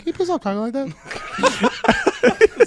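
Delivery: put-on very high, slow small child voice; innocent kid. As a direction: imitate an innocent, earnest plea. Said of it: "So innocently".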